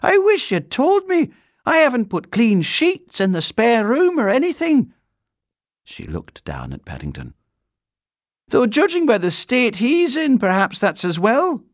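loud, high-pitched fussing; flustered housekeeper. A narrator's reading. Housekeeper with no clean sheets ready, flustered. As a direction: scold and fret in a high, strident voice.

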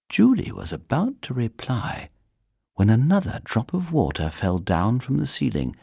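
ordinary delivery, nothing marked

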